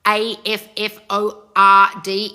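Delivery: slow, letter-by-letter spelling. Real, not acted. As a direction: spell A F F O R D one letter at a time. Spells out a word she avoids.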